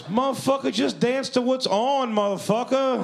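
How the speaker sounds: high-pitched, exasperated host voice; impatient yell. Retold or imitated. Host orders Pooh to dance to playing music, impatient yell.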